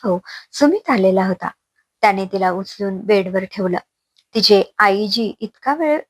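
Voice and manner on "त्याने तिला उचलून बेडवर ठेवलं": ordinary delivery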